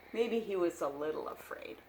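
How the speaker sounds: ordinary delivery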